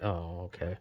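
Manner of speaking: imagined hurt reply after being cut off, deflated. Pretend. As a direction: let the air go out of it. His own deflated reply to cousin.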